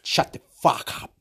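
hissed under the breath, unvoiced; a hushed, voiceless threat; rasped whisper. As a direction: spit it out in a voiceless rasp. Quoted rant turns hushed.

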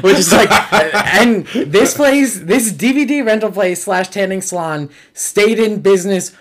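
high, loud telling through laughter; laughing. Real, not acted. Teller amused by the rental store slash tanning salon, laughing. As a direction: push the story out while cracking up. Cracking up at own anecdote.

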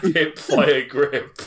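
laughing. Real, not acted. Laughing answer.

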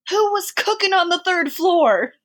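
high-pitched, booming mock-stern fireman. Fireman confronting residents about the cooking, mock stern. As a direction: demand it like an imitation of a stern fireman. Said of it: "with the sternest voice and a big stash".

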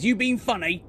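menacing orc taking offence; orc leader. Acted. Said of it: "and he says".

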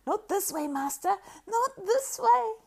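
high, loud squeak; squeaking Gollum. A narrator's reading. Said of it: "wringing his hands and squeaking".

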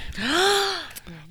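sharp high gasp of shock; surprised gasp. Real, not acted. News of a marriage.

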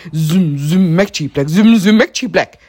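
loud buzzing threat; angry bee. A narrator's reading. Bee chases puppy.

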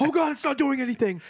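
high, loud mock panic; mock panicked cop. Officer panicking as the suspect keeps coming, mock terror. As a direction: shriek it as the taser fails.

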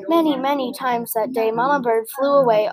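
plain reading voice

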